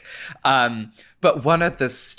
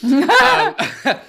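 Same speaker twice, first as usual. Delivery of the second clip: high burst of laughter; 6 dB louder than usual. Real, not acted. Laughs at own impression.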